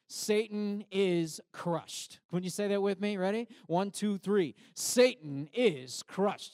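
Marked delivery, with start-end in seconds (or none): 0.1-2.2 call-and-response declaration. Shown as a demonstration. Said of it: "Let's say this together". 4.7-6.5 slow, loud, drawn-out chant; loud slow declaration. Shown as a demonstration. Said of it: "You online, join us".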